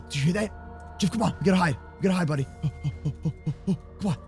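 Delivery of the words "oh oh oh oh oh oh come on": ordinary talk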